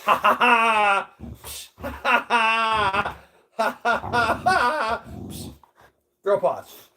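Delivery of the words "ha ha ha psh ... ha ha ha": loud, high, drawn-out ha-ha; playful ha-ha laugh. Pretend. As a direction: belt out slow mock laughs in bursts. Playful noises during paw game.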